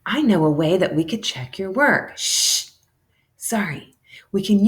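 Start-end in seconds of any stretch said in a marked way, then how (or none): 2.2-2.7 quick hushing shh; sharp shh; shushing. Acted. Hushing the others again.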